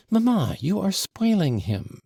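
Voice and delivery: put-on high, girlish call from behind a door; a lightly voiced girl crying out to her mamma; little girl's cry. A narrator's reading. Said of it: "Lise's little voice cried".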